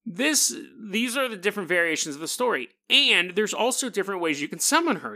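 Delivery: normal talking voice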